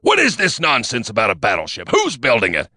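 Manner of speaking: loud angry demand. A narrator's reading. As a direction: demand it loudly. Inskip still roaring at narrator.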